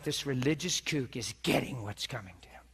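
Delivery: gleeful whispered jab, hushed and low; sneaky retold whisper; mock whisper. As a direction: whisper it like she's savoring his comeuppance. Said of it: "She's like".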